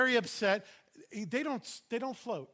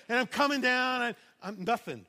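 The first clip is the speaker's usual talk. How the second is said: normal talking voice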